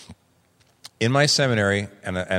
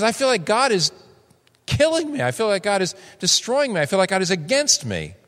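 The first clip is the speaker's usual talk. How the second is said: much higher, pained cry of despair; anguished wail; 10 semitones higher than usual. Acted. Preacher giving Job's cry against God, high anguished wail. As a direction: wail it like you're being destroyed.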